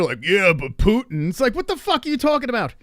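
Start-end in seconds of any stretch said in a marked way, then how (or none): 0.2-1.3 mock dopey retort. Mocking the caller's retort.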